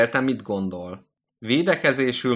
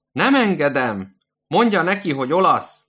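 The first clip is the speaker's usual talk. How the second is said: loud refusal; 8 semitones higher, 6 dB louder than usual. Acted. Old man keeps yelling.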